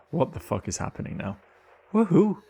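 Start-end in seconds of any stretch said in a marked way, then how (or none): 1.9-2.4 high, loud party whoop; cheering woohoo. Acted. Cheering at birthday scene.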